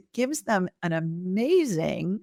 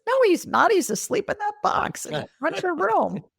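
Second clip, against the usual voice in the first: put-on much higher, a small girl's matter-of-fact correction; little girl's voice; 13 semitones higher than usual. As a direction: voice a tiny girl who is certain she knows. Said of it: "And little Susie says".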